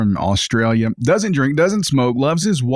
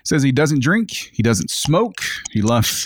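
usual speaking voice

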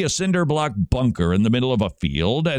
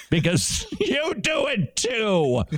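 laughing; 11 semitones higher, slower than usual. Real, not acted. Teasing Southern listeners, laughing.

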